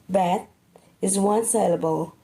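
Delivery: ordinary delivery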